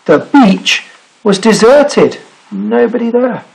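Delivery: story narration. Acted. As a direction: build suspense with pauses. Reads picture book aloud.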